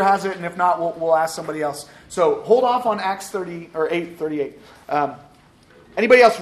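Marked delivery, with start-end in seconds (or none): 6.0-6.4 calling out. Real, not acted. Calling for volunteer readers.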